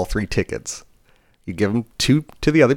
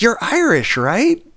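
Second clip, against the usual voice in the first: very high, excited imitated question; eager customer; 13 semitones higher, 3 dB louder, much slower than usual. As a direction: mimic a stranger convinced he knows you.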